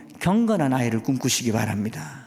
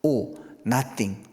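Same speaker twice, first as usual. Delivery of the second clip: slow punchline; 3 semitones lower, much slower than usual. Acted. Punchline of GOD minus G.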